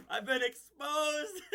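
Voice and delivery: high, drawn-out wail through laughter; hammed-up horror at being caught; mock dismay. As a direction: wail it slowly, half-laughing. Acted dismay at being found out.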